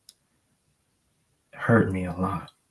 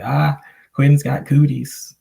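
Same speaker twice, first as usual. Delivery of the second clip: put-on kids taunting; 6 semitones higher, 6 dB louder, slower than usual. Kids taunting him at school.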